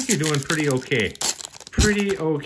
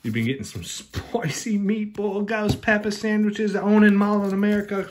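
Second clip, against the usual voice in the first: high, put-on Aussie accent; playful Aussie voice, pitched up; mock Australian accent; 10 semitones higher than usual. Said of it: "oh, the Aussie!".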